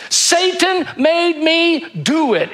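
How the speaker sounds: high, slow whiny excuse; mock excuse. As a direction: whine it like a caught child. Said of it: "is basically what she said".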